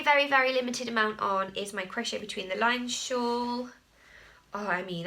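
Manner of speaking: ordinary delivery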